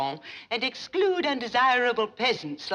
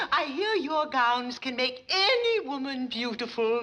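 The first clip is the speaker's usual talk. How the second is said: ordinary talk, nothing marked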